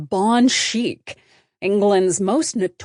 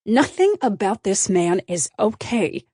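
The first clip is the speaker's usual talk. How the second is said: ordinary delivery, nothing marked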